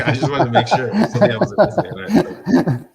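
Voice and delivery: high, loud laughing through words; giggling at himself. Real, not acted. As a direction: laugh it out while talking. Host laughs at own question.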